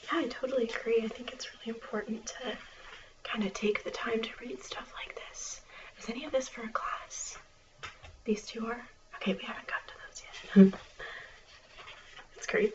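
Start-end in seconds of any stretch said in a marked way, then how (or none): 1.0-7.4 library whisper. Acted. 9.2-10.7 library whisper. Acted.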